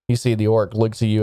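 ordinary talk, nothing marked

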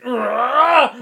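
loud, high snarling roar; monster roar. Acted. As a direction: roar as you bite in. Frigimon tears into candy cane.

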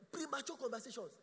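ordinary delivery

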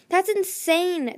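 high, loud, drawn-out objection; alarmed protest. A narrator's reading. Starflight horrified at going into the hole, alarmed protest. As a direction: protest in stretched-out disbelief.

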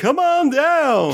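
high, slow, drawn-out host call; game show host. Pretend. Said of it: "Why do I feel like I'm on The Price is Right".

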